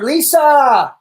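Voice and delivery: loud, high call across the room. Real, not acted. Host calls his wife loudly mid-stream. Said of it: "guys, I'm yelling".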